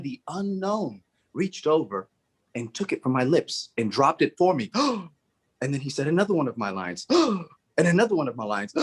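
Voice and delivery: ordinary delivery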